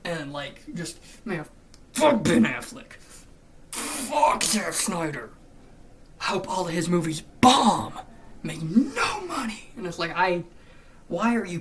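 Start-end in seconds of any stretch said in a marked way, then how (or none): 1.9-2.9 loud mocking fan rant; shouting like a toxic fan; mock angry fan. Mocking toxic fans online, loud mock rant. 3.7-9.7 slow, loud sneering fan voice; mock hater. Quoting hateful fans online, slow loud mock sneer.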